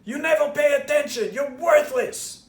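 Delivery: high, loud angry scream; screaming dad. Retold or imitated. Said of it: "start screaming at me".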